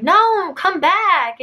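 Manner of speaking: high, mock yell; retold scream. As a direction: imitate someone screaming after a runaway. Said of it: "I heard him scream".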